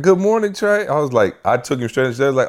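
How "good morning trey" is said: high-pitched mock teacher greeting. Teachers greeting the boy in the classroom, high imitation. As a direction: imitate a teacher's singsong welcome. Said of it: "The teachers are like".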